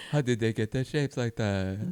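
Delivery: put-on slow, slurred, dopey drunk. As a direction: marvel at fireworks like a drunk. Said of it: "That's my drunk voice".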